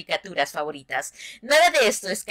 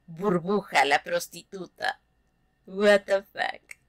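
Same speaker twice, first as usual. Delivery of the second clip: normal talking voice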